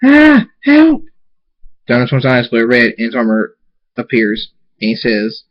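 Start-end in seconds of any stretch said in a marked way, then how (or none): 0.0-1.0 high, loud terrified shriek; screamed cry for help. A narrator's reading. Twilight pounced on by a timberwolf, screaming for help. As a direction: scream out for rescue. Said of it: "Twilight screams".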